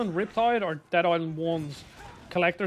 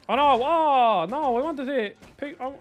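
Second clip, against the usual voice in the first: higher, slow dismayed cry; 7 semitones higher, 4 dB louder than usual. Real, not acted. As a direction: groan it in sudden alarm. Dismay at game mechanic.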